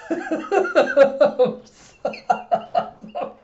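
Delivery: apologizing through real hard laughter; high, stretched words breaking into wheezes; cracking up too much to talk; helpless laughing. Real, not acted. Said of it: "I heard myself laughing".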